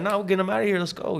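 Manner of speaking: his mom lightly mimicked, waving the problem away; imitating mom. Mom voiced in his story.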